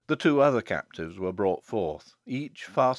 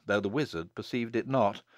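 plain reading voice

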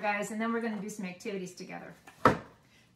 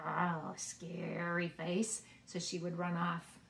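mock scary face; 2 semitones lower, 4 dB quieter, slower than usual. Shown as a demonstration. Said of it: "Can you make your scariest face?".

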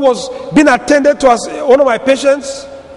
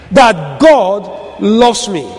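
slow, low assurance; 5 semitones lower, much slower than usual. Real, not acted. Preacher lands key line.

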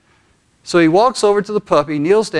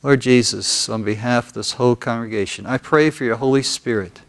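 guided prayer; 2 semitones lower than usual. Real, not acted. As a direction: lead them gently into prayer, leaving room after each phrase. Leads congregation in prayer.

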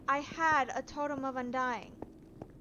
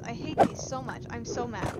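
ordinary talk

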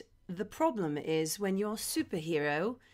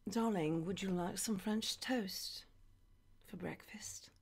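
soft whispered sweet talk; seductive whisper; 3 semitones lower, 11 dB quieter than usual. Retold or imitated. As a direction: whisper it to a lover the morning after. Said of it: "if we're together".

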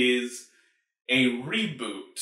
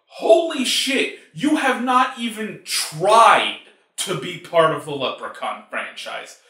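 frustrated shout; 4 dB louder than usual. Real, not acted. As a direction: yell your disbelief at the filmmakers. Ranting about the movie.

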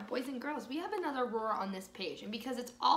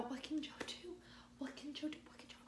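whisper; 3 semitones higher, 13 dB quieter, much faster than usual. Acted. Repeated suspense chant to children.

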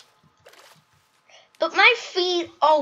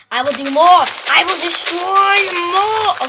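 villain voice; 5 semitones higher, 8 dB louder than usual. Acted. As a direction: gloat like a cartoon villain. Gamer playing villain after explosion.

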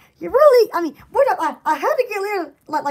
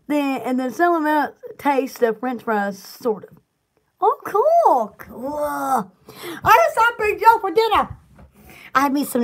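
ordinary delivery

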